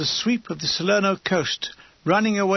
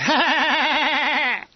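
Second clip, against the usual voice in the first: awkward forced cackle of a Cockney lady; forced embarrassed laugh; 7 semitones higher than usual. A narrator's reading. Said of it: "that forced Cockney laugh of embarrassment".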